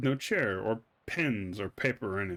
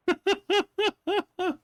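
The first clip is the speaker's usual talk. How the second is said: squealing high-pitched laugh; 5 dB louder than usual. Real, not acted. Player cracking up at the game, high squealing laugh. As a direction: burst into a high giggle.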